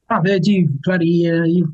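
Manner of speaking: lightly put-on Kiwi accent. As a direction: quote a curious New Zealander. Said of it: "a bit more of a Kiwi accent".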